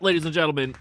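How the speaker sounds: normal talking voice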